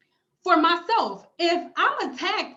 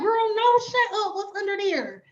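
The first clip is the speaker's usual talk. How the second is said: put-on high-pitched girlfriend-gossip astonishment; mock-scandalized, high and eager; acted eager disbelief; 7 semitones higher than usual. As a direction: play amazed and hungry to hear more. Acts out eager reaction to news.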